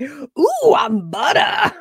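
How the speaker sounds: high, loud, silky crooner voice through a laugh. Retold or imitated. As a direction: croon it like a smooth soul singer. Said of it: "The voice, baby, is what that is".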